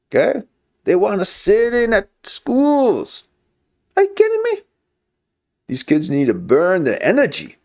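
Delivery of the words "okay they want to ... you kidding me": high, slowed preachy lecture voice; put-on preachy voice. Vlogger lecturing viewers on sitting, in a high preachy voice. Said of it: "I don't need to be talking like this".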